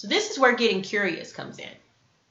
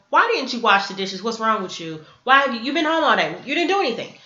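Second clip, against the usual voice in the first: loud, rising rant, acted out; blaming; 3 semitones higher, 6 dB louder than usual. Scolding someone home all day about dishes, blaming. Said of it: "the energy behind blaming someone".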